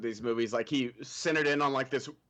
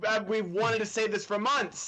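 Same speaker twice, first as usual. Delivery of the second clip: higher, laughing blurt; relieved laughing quote; 7 semitones higher, 3 dB louder, slower than usual. Retold or imitated. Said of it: "everyone's like".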